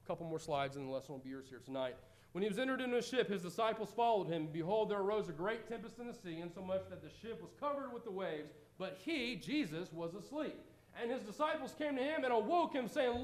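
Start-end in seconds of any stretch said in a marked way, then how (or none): 2.3-13.2 rising, dramatic Bible reading; scripture reading. Acted. Preacher reads the storm passage aloud to the congregation, dramatic.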